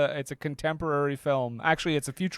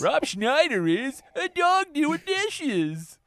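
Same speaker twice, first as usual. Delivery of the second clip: silly high voice; 12 semitones higher, much slower than usual. Pretend. Comic impression joke.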